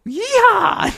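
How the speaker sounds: very high, loud taunting shout; obnoxious yell. Retold or imitated. Runner taunts the driver, obnoxious high shout. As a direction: imitate an obnoxious holler. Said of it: "Like a really obnoxious".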